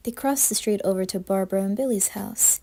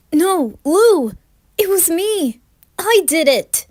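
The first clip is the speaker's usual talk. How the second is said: much higher, loud anguished cry; desperate shout; 10 semitones higher, 10 dB louder, slower than usual. Acted. Jeff shouting to the cops to take him instead, desperate yell. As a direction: scream it to stop the arrest. Jeff protests Lou's arrest.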